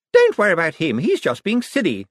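high, airy, dismissive woman. A narrator's reading. Sister waving away her brother's illness, dismissive. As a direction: brush it off in a high voice. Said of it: "tossed her admittedly fine locks dismissively".

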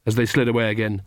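ordinary delivery, nothing marked

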